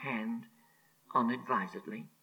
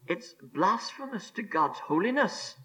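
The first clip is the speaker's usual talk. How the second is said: ordinary talk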